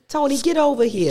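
high-pitched mock mobster accent; Tony's mobster pal. Co-host joining the bit, in a high mock mobster accent. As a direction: call Tony over like a New Jersey wise guy. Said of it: "I don't like it, Tony".

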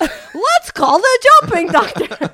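high, loud and slow, all make-believe; mock excited. Parent hyping a toddler with a pretend phone call, high and slow. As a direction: sell the game to a little girl. Telling the kid to call the doctor.

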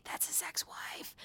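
breathless whispered aside. Real, not acted. Host whispering a quick aside to viewers, hushed. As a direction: drop to a whisper for the side note.

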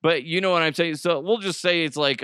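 ordinary talk

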